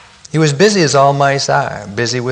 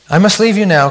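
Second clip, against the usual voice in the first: louder, higher interjection; cutting in; 8 semitones higher, 6 dB louder than usual. A narrator's reading. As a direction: cut in with a lifted, firm voice. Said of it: "cut in Raccoon".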